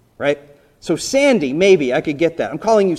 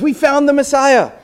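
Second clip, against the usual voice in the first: high, louder burst of good news; excited announcement; 9 semitones higher, 5 dB louder than usual. Acted. Brother bursting in with the big news, excited. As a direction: blurt it out like you can't contain it.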